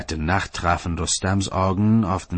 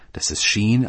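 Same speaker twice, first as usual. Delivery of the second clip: ordinary delivery, nothing marked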